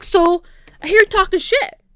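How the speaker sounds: high, loud, pointed challenge; confrontational. Retold or imitated. She confronts the dude to his face, confrontational.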